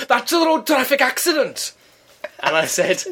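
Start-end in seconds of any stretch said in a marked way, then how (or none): 0.1-1.7 high indignant mock voice of an older man; pompous neighbour. Neighbour lectures her at the door, high and pompous. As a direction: puff up like an officious ex-copper.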